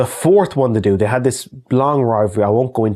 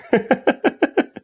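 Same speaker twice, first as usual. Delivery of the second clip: high laugh. Real, not acted. Reacting to a viewer's question.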